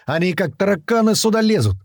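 high alarmed report; urgent high-pitched call; shouting. A narrator's reading.

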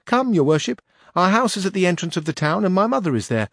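put-on high, eager girl's voice; young girl. A narrator's reading. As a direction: pitch it up like a skipping girl.

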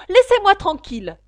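high, loud, exasperated; indignant. Acted. Alice telling the pigeon off, indignant shout. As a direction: push the pigeon away with your voice. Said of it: "dit Alice avec indignation".

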